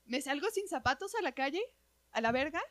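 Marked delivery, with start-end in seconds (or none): none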